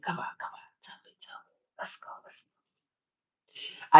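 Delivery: urgent gangster whisper. Retold or imitated. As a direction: hiss it like a mobster leaning in. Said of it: "they're whispering".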